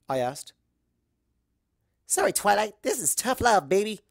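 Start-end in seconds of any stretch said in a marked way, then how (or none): 2.1-4.0 higher and louder, cocky; sling tough love like a sporty coach; cocky athletic pony; brash tough tomboy. Acted. Rainbow Dash voiced, brash.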